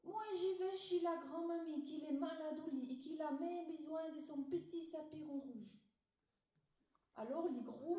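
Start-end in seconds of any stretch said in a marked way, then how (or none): 0.0-5.7 a hint of a little girl, a bit higher; lightly voiced little girl. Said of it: "il a répondu".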